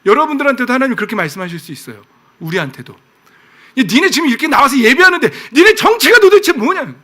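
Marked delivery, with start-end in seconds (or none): 2.9-7.0 loud, high-pitched scolding; God. Acted. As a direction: confront them with an exasperated shout. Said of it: "하나님이 이러는 거예요". (높고 큰 소리로 다그치는 하나님의 호통)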